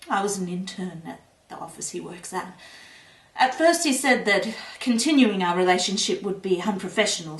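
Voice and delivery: normal talking voice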